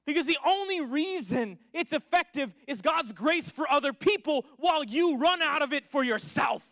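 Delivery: rising, loud, urgent preacher's call; fiery preaching. Acted. Preacher warning his congregation, fervent and loud.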